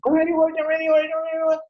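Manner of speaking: mock panic, chanting the worry; repeated worried whine; anxious self-talk. Shown as a demonstration.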